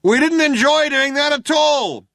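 high, loud, slow holler; shouting cop. A narrator's reading. Second cop to fugitives about the shooting, shouting. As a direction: shout it out with long vowels. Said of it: "shouted the other cop".

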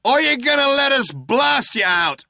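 high, loud shouted threat; shouting cop. A narrator's reading. Cop threatening to blast the fugitives out, shouting. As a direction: bellow the threat, pitched right up. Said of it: "shouted one of the cops again".

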